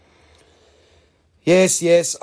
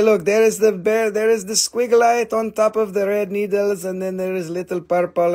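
ordinary talk, nothing marked

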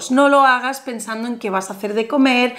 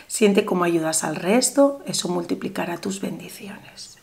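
guided meditation; 4 semitones lower than usual. Acted. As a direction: lull the viewer into calm visualization. Leads viewer through visualization.

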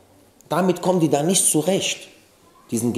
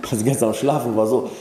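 ordinary talk

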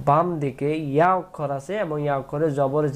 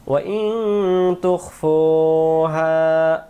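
slow melodic tajweed chanting; slow chanted Quranic recitation; 2 semitones higher, much slower than usual. Shown as a demonstration. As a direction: draw the vowels out in a sung recitation. Recites verse demo.

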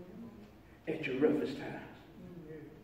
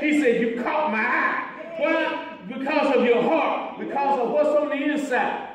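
loud, raised preacher's cadence; full-force preaching; 10 semitones higher, 8 dB louder than usual. Acted. Preacher telling church why God chose them, full-force preaching. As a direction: drive each phrase home from the pulpit.

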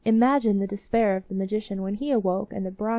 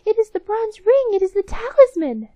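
high, loud delighted shout; joyful cry; 12 semitones higher, 9 dB louder than usual. Acted. The mice cry out joyfully on finding the ring, high and loud. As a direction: cry out with joy at the find. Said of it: "they cried joyfully".

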